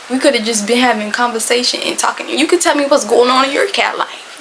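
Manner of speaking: ordinary talk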